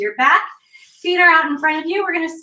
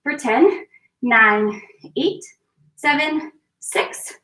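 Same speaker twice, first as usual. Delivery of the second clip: ordinary delivery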